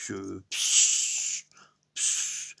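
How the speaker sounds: soft imitated pss sound; peeing hiss. Imitating himself peeing, soft hiss. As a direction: hiss out the stream softly.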